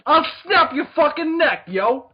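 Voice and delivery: slow, loud Brooklyn tough-guy threat; Brooklyn accent. Acted. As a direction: drag it out like a Brooklyn thug. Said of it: "the Doctor is from Brooklyn".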